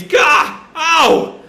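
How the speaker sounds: loud, high yelps of pain; mock scream. Retelling a man hit in the shoulder, mock pained scream. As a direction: yelp as if struck, hammed-up. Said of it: "he just screams".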